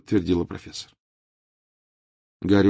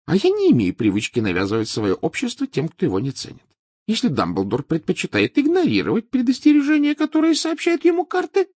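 Trelawney voice; 14 semitones higher, 5 dB louder than usual. Acted.

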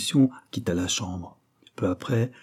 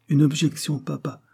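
a touch of flustered embarrassment, pitched up; balbutiement gêné; 6 semitones higher than usual. A narrator's reading. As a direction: answer higher, caught off guard. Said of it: "balbutia-t-elle en rougissant". (balbutiement embarrassé, plus aigu)

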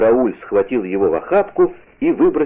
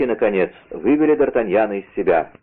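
ordinary delivery, nothing marked

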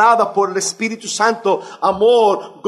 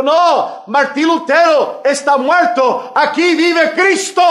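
high and loud, triumphant declaration; loud reply; 8 semitones higher, 5 dB louder than usual. Retold or imitated. Luther answering the crowd from inside, loud proud reply. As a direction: proclaim it from inside the house. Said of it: "en voz alta de dentro de la casa".

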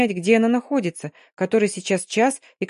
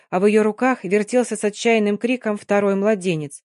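ordinary delivery, nothing marked

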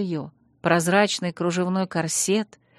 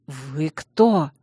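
strained out; 4 semitones lower than usual. A narrator's reading. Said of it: "наконец выдавил из себя мужчина".

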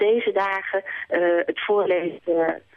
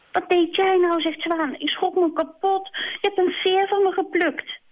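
much higher, shocked swan; indignant swan; 12 semitones higher than usual. Acted. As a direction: scold the hare in a fright. Zwaan startled and indignant.

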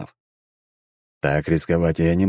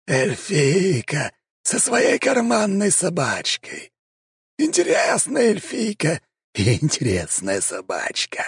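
high, slow, sneering creature voice; mocking vampire; 11 semitones higher, slower than usual. A narrator's reading. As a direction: sneer it down at them. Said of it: "с усмешкой произнёс вампир".

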